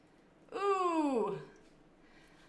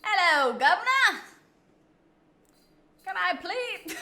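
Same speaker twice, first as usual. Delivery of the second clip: high, loud put-on English accent; mock English accent; 8 semitones higher, 6 dB louder than usual. Asked for best English accent, hammed-up English accent. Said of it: "give us your best English accent".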